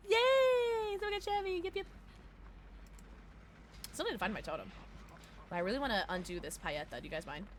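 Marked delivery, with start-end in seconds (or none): 0.1-1.8 high celebratory yay; excited cheer. Real, not acted. Celebrating a viewer's news.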